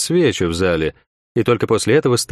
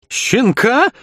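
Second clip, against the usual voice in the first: much higher indignant squeal of the word «Щенка»; outraged; 14 semitones higher than usual. A narrator's reading. Young commander insulted by the fat man, outraged high echo. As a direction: fling «Щенка?» back, stung. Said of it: "взвился молодой командир".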